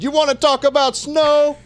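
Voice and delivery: high mock-dramatic question, reading viewer Mario's comment; playful put-on voice; imitating a chat comment. Reading a viewer's chat comment aloud, high mock voice. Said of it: "And he said it just like that".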